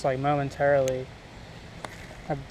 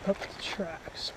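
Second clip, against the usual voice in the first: slow breathy whisper aside; 2 semitones higher, 10 dB quieter, much slower than usual. Real, not acted. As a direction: whisper it slowly to yourself.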